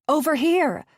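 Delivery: high, loud call across the water; shouting out. A narrator's reading. Gabby calling out to the men on the raft, loud shout. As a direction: yell to grab their attention.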